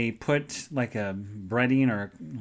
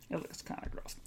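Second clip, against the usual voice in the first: whispered aside; 3 semitones higher, 18 dB quieter than usual. Real, not acted. Looking at the sandwich picture, whispering to viewers. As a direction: say it under your breath, a bit disgusted.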